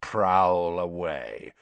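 slow, low, drawn-out threat; full of menace. A narrator's reading. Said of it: "his voice was full of menace".

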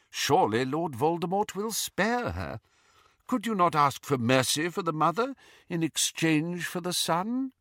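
lightly voiced old headmaster; a hint of a grave old wizard. A narrator's reading. Dumbledore voiced in story.

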